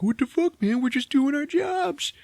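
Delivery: high whine of scared workers; whiny engineers. Retold or imitated.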